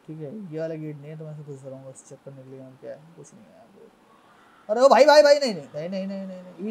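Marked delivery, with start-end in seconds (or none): 4.7-6.7 panicked shout. Real, not acted. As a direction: scream no no as it catches you. Chased by spider in game.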